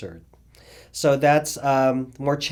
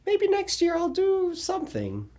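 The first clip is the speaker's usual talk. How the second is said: imagined inner voice. Retold or imitated. Retold inner thought, high voice.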